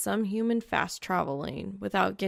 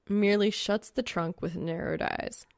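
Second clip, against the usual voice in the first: plain reading voice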